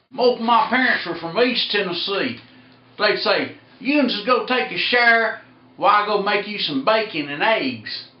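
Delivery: loud, high East Tennessee twang; East Tennessee accent. Retold or imitated. Imitating parents sending someone to the shower, East Tennessee accent. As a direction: call it out like East Tennessee folks. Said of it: "they really do talk like that over in East Tennessee".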